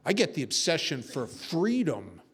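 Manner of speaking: normal talking voice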